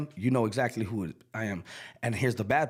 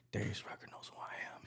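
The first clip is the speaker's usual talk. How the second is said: whisper; 3 semitones lower, 15 dB quieter, faster than usual. Retold or imitated. Awestruck aside to his manager.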